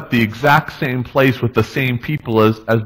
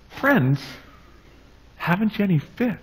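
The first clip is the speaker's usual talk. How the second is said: much softer, gently asked; Jesus quietly asking the disciples; gentle question; 8 semitones higher, 8 dB quieter than usual. Acted. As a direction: let it fall softly, probing.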